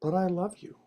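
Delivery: affectionate cat-talk. Real, not acted. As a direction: tell the pet tenderly, small and high. Makes up with his cat.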